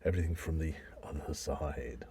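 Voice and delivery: whispered, breathy and hushed; spooky whisper. Pretend. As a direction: whisper it like a ghost story. Spooky echo of "all things scary.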